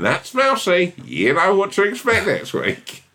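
very high, slow mouse voice; rubbery high squeaky toy. Pretend. Voicing a mouse.

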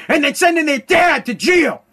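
outraged yell; indignant shout. Real, not acted. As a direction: slam the last words in outrage. Ranting about kids jailing dads.

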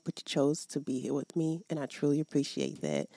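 ordinary talk, nothing marked

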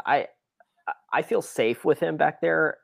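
usual speaking voice

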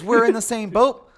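high, loud sing-song mom voice; gushing mom impression. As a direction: ham up an overeager mom. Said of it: "She goes".

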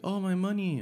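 a squeaky mock-girlfriend gasp; girlfriend's voice. Girlfriend remembers the forgotten money, high mock surprise.

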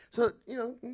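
usual speaking voice

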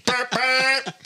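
high honking noises; imitated truck horn. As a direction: honk twice like a little truck horn. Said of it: "used his stomach to hit the horn".

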